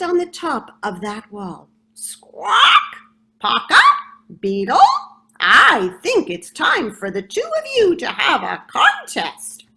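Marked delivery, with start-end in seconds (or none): 1.9-9.6 loud, high squawking parrot call. Acted. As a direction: call them out like a bossy parrot. Parrot calling the two.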